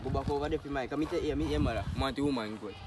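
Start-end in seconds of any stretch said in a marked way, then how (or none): none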